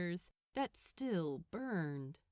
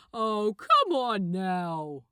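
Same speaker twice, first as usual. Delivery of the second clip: reasoning; 9 dB louder, slower than usual. Acted. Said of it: "reasoned Gabriel".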